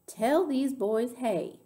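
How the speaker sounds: drawn-out storybook command; firm instruction. A narrator's reading. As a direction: tell them slowly and pointedly. Gloria firmly orders girl to greet guests.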